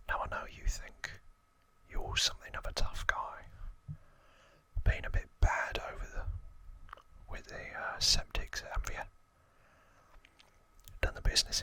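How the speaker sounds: whispered Cockney gangster voice; Cockney accent. Acted. Albert Crisp warning a newcomer to London, whispered Cockney accent. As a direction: put on your best Cockney, all in a whisper. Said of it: "That's my best Cockney accent".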